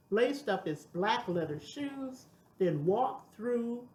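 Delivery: ordinary delivery, nothing marked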